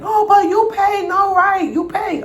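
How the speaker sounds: very high mocking landlord voice; shrill landlord. Landlord demanding rent, high and shrill. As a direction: squeal the demand. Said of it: "She leave screaming".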